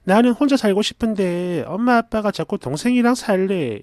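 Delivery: ordinary delivery, nothing marked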